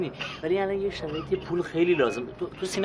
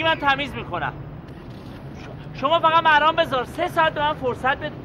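higher, pleading phone call; 6 semitones higher than usual. Acted. A worker begging the pool owner for time, pleading higher. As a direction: beg the boss for one more chance.

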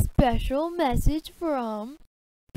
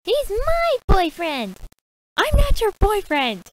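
angry shouting; 4 semitones higher, 9 dB louder than usual. Acted. Shouted quarrel over boyfriend.

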